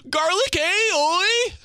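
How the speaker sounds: high, loud goofy "oi"; mock silly character. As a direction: ham it up. Silly put-on voice.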